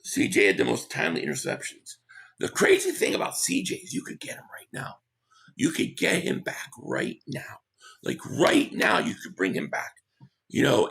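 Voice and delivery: normal talking voice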